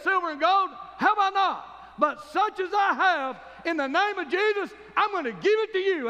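3 boast it out, triumphant; 1 bold declaration. Retold or imitated. 4 Peter declaring to the beggar, loud and triumphant. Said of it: "He said".

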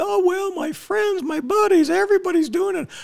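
very high, whiny mocking voice for vaccinated women. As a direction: mimic her worrying about her friends, way up high. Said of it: "especially the women".

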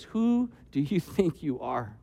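soft, slow, gentle challenge; kindest of ways. Shown as a demonstration. Elihu gently confronts Job, in the kindest, softest way. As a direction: ask it very softly and slowly, as kindly as possible. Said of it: "In the kindest of ways".

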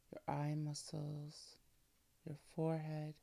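hushed, breathy relaxation whisper; soft spa whisper. Acted. Earlier reader: soft spa whisper.

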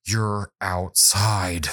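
slow, pointed insistence with long pauses; frustrated child. Retold or imitated. Daughter looking back at her dad across the table, imitated frustration. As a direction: stretch it out word by word, mimicking a fed-up daughter. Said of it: "she says it like that".

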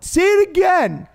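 mock crowd heckle, calling up to the stage; teens calling out. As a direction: egg the rabbi on to repeat it. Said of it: "I'm like, "What?".

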